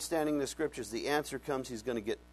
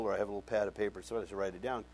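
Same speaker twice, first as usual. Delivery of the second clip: usual speaking voice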